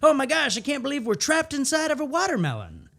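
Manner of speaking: high, loud, alarmed Oh my gosh; excited scene partner. Shown as a demonstration. Scene partner opening an absurd improv scene, high and alarmed. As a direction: burst in with wide-eyed panic. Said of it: "your scene partner comes out and says".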